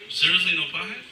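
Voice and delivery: ordinary talk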